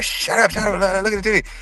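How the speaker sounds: lightly voiced classmates hissing at him to hush. Classmates shushing the narrator toward the TV, mild high hush.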